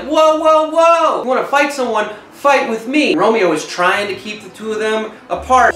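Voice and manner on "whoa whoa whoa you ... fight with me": higher, louder whoa, whoa; mock challenge. Mercutio stepping in to challenge Tybalt, loud and higher. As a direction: step in and call him out, higher and louder. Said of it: "Mercutio ... he's like".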